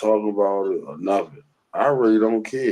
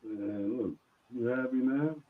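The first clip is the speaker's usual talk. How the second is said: faint and far from the mic; barely audible; 8 dB quieter than usual. Real, not acted. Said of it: "We, we can hardly hear you".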